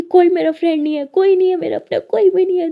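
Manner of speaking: high, loud tearful cry; crying outburst. A narrator's reading. Lily cries out to her friend, high and tearful. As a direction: sob it out, pushing her away. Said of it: "तो lily बहुत रो रही होती है".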